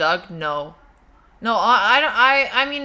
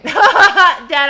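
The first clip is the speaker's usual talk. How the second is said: loud, high word through laughter; laughing; 8 semitones higher, 11 dB louder than usual. Real, not acted. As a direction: call out to dad while cracking up. Laughing at remark on her accent.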